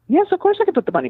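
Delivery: husband quickly promising his lawyer to return the money, high and fast; eager client. Retold or imitated. Client eagerly agrees, retold.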